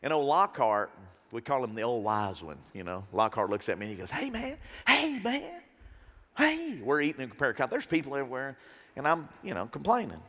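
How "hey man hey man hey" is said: high, slow, drawn-out hey; imitated friend interjecting. Friend cutting in on a complainer at dinner, imitated interjecting. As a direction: pitch it up to get his attention.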